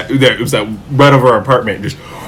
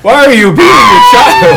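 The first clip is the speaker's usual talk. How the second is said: laughing shout; 11 semitones higher, 4 dB louder than usual. Real, not acted. Playful mock outrage, laughing.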